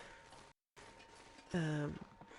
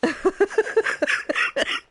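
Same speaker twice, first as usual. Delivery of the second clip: laughing; 14 semitones higher, 12 dB louder than usual. Real, not acted. Laughing hard at game death.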